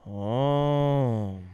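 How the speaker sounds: long, drawn-out alien word. Retold or imitated. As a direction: croon the single word slowly. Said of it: "and says".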